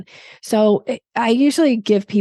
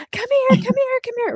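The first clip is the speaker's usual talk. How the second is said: dog calling energy. Shown as a demonstration. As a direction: overdo it like calling a puppy. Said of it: "dog calling energy".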